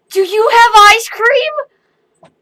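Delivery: put-on very high, loud, slow child plea; drawn-out kid asking for ice cream; little girl voice. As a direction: beg like a hopeful toddler. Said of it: "She goes".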